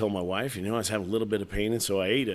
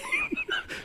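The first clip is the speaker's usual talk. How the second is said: high, sudden laugh; short laughing burst; burst of laughter; 4 dB quieter than usual. Real, not acted. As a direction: break out laughing. Laughing at celery detail.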